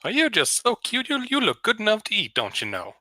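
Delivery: high, coy Southern vampire flattery; Lindsey Graham voice. Acted. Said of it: "Southern Belle sort of character".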